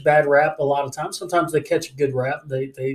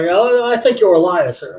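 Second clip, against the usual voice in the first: higher, louder mock accusation; raised-voice retort in a retold row; accusing; 3 semitones higher, 7 dB louder than usual. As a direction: imitate an indignant caller hitting back. Scammer's accusation acted out.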